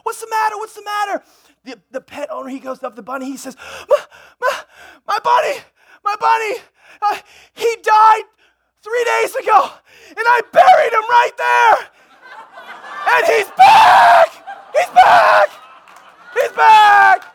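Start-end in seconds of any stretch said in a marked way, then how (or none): 0.0-1.2 high, urgent acted fretting; alarmed neighbor. Dog owner runs over, alarmed. 3.9-17.2 high, loud tearful wail building to a shriek; horrified pet owner. Acted. Shocked owner sees buried bunny returned.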